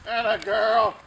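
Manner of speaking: high, loud praise for a pup; excited praise. Real, not acted. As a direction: cheer her on. Praising puppy.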